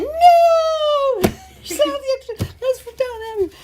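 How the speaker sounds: high, loud, thrilled cry with a laugh; excited squeal. Retold or imitated. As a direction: replay the moment of recognition, squealing. Excited recognition of Yorkshire accent.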